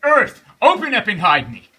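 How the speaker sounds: loud, desperate plea to the earth; exhausted boy crying to earth. A narrator's reading. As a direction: beg the earth to open. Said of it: "he cried".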